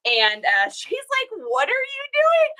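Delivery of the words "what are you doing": imitating high-pitched incredulity; baffled friend. Best friend who isn't online asks about lives, high-pitched bafflement. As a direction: squeal the question in mock bafflement.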